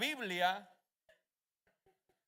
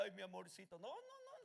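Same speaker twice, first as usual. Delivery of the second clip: softer, mock tender cooing; mock sweet talk; 10 dB quieter than usual. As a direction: tease like a sweet-talking lover. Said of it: "nunca he oído a Satanás que me diga". (imitando un susurro cariñoso de burla)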